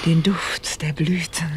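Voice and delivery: slow dreamy whisper. Acted. Madame whispering dreamily of spring to the Marquis. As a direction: breathe it out, savouring.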